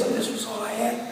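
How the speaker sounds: soft, airy, strained false-cord voice; damaged voice demo. Shown as a demonstration. Speaker demonstrating his damaged false-cord voice, strained and soft. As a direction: show the congregation how little voice was left. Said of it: "you sound like this instead of like that".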